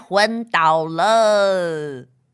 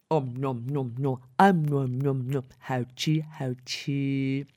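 munching noises; 8 semitones lower, 6 dB quieter than usual. Acted. Characters eating pudding noisily.

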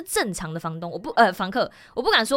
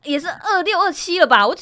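much louder and higher, exasperated protest; indignant outburst; 11 semitones higher, 8 dB louder than usual. Real, not acted. To her friend, about the landlady: loud exasperated protest. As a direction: fire it back, fed up and loud. Protesting being called young.

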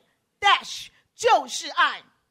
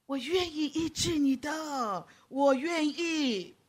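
slow, soft and gentle promise; tender; 2 semitones higher, 5 dB quieter, much slower than usual. Pretend. Jesus touches the leper and promises healing, slow and soft. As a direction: ease each word out gently.

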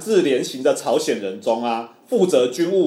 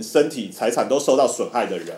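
normal talking voice

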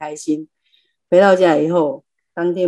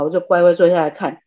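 ordinary delivery

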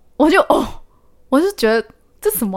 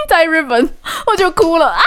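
laughing at herself; 6 semitones higher than usual. Real, not acted.

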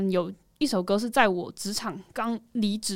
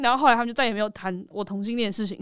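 ordinary delivery

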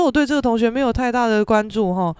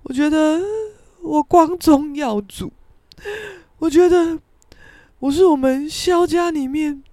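higher, halting crying voice; mock-sob the proud words out in pieces; imitated tearful speech; 6 semitones higher, 3 dB louder than usual. Father proud of his two children, hammed-up crying. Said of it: "他给我大哭欸".

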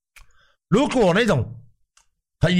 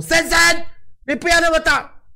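much higher, scolding staff voice; high nagging voice telling the patient to keep it down; annoyed hospital staff; 12 semitones higher than usual. Retold or imitated. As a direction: play the nurse shushing a noisy patient. Staff voice again.

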